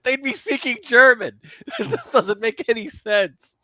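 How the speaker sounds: high, laughing rant; laughing exasperation. Real, not acted. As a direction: protest the absurdity through laughter. Laughing rant about film accents.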